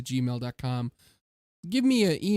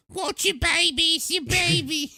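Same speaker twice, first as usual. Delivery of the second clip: very high-pitched chicken puppet voice; high cartoon nagging chicken; crazy chicken wife; 13 semitones higher than usual. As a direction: screech like a crazy hen wife. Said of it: "crazy wife that's a chicken".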